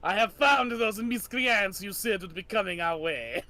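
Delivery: put-on villain Lefebvre gloating in French accent. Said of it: "waiting for this accent".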